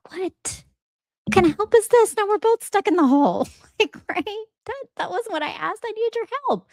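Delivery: high-pitched, comic disbelief; incredulous protest. Acted. Stuck man to the rescuer who jumped in, incredulous squeal.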